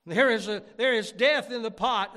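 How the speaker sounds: loud, high scriptural cry; dramatic pulpit shout. A narrator's reading. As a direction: call out in alarm to the prophet. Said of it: "they cried out and said".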